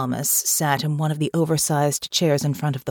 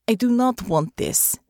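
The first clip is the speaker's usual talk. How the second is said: put-on firm, lightly Russian-accented reply; Russian-accented woman; 6 semitones higher than usual. A narrator's reading. Natasha answers Hansen.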